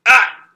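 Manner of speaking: sharp pained yelp, loud and high; pained cry. Acted. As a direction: cry out as if stepped on. Character hurt, cries out.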